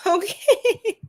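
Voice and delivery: ordinary talk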